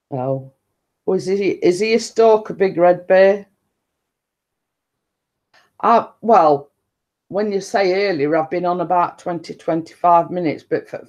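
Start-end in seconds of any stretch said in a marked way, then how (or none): none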